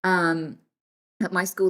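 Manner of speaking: ordinary talk, nothing marked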